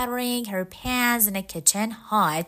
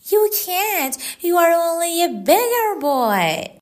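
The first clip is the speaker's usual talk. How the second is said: scornful woman; 9 semitones higher, 8 dB louder than usual. A narrator's reading. Woman scorns beggar boy.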